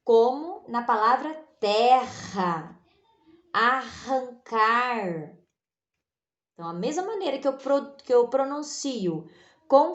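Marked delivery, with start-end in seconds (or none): 3.5-5.4 arrancar said slowly with a strong rolled rr; strong R demo. Shown as a demonstration. Teacher to class, showing the strong R in arrancar. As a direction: drag out arrancar, hitting the rr hard. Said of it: "A pronúncia é mais forte".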